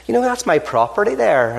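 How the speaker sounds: a lilting Irish farmer sizing up a stranger. Acted. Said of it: "he says".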